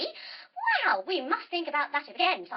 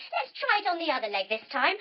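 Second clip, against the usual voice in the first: ordinary delivery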